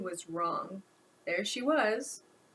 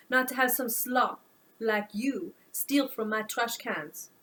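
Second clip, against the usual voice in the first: put-on French accent; 3 dB louder than usual. French woman retorts.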